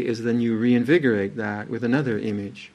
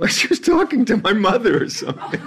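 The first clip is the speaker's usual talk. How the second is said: laughing; 13 semitones higher than usual. Real, not acted. Laughing while telling funny moment.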